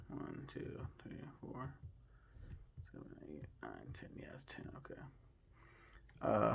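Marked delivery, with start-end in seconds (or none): 0.1-5.0 whispered counting. Real, not acted. Counting under breath.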